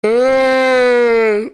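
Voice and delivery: mock anguished wail, very high and loud; comical wail. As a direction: ham up a wailing cry. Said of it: "It's a comical reenactment".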